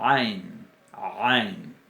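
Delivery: "ain" lenta con constricción en garganta; ain gutural. Shown as a demonstration. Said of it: "que es una fricativa uvular sonora". ("ain" fricativa sonora, lenta)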